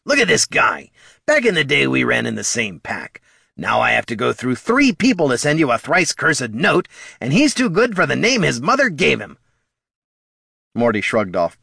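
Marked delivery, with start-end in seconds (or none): 0.0-9.4 higher, louder mocking rant; worked-up, raised kobold voice; indignant kobold Bert. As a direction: complain scornfully about an old packmate.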